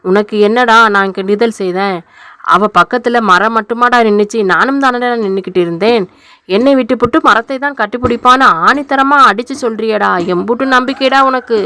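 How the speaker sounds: ordinary delivery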